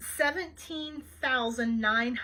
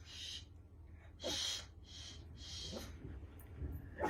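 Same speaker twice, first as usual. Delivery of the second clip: soft, repeated voiceless shushes; shushing; 16 dB quieter, slower than usual. Real, not acted. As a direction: hush a barking dog softly. Hushing the barking dog.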